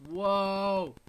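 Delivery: loud, high amazed cry; surprised whoa. Real, not acted. Streamer watches a shield soldier leap a peasant, amazed. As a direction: gasp out in amazement. Unit leaps over peasant.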